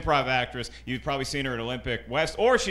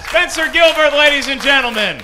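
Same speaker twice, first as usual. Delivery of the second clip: announcer call-out; 11 semitones higher, 12 dB louder than usual. Real, not acted. Host announcing comic.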